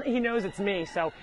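usual speaking voice

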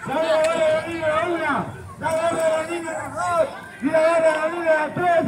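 announcer call. Real, not acted. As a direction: call out like a street barker. Street performer calling out hat.

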